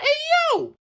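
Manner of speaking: high, hammed-up excited yell; mock-excited squeal; excited cheer. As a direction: whoop it as a delighted greeting. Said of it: "they're all excited".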